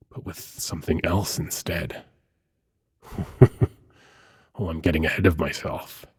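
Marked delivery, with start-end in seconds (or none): 3.1-3.7 short amused chuckle. Acted. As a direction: chuckle briefly to yourself. Amused at getting ahead of himself.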